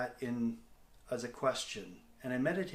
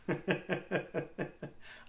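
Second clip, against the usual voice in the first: breathy, unvoiced nervous giggle; mock the awkward nervous laugh; imitated nervous laughter; 4 semitones higher than usual. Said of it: "nervous laughter".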